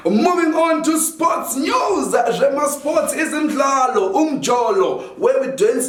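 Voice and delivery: high, slow newsreader voice; news anchor. Acted. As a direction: announce it like a TV anchor. Mock sports news read.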